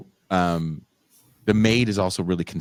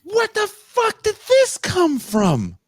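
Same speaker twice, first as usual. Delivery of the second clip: very high, slow shrieking disbelief; mock-horrified falsetto outrage; shocked; 4 dB louder, slower than usual. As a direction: drag it out in high-pitched horror. Shocked viewer reaction.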